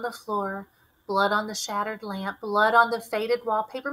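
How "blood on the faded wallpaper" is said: plain reading voice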